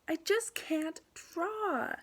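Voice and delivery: high, slow exasperated wail; frustrated. Acted. Pupil to art teacher over blank paper, frustrated. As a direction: drag it out loudly in frustration. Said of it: "I now know for sure she's feeling frustrated".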